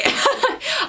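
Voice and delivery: high giggling laugh; high squeal of a laugh; high burst of laughter. Real, not acted. Laughs at own reaction.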